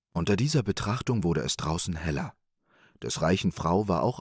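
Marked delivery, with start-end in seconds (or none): none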